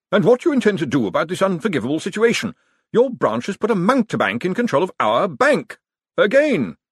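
fast, high, loud outrage; accusing. A narrator's reading. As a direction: hurl the blame across the room. Josephine attacks Cosmo's branch.

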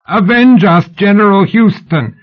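high, tight-throated cry; sudden high-pitched outcry to a general; shrieked plea. A narrator's reading. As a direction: shriek it through stage fright. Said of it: "Sybil shrieked, her throat constricted with stage fright".

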